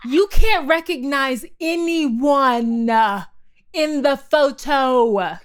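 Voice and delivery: very slow, drawn-out emphasis; exasperated punchline. Real, not acted. Telling friend about the blurry photo, exasperated. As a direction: drag out each word in disbelief.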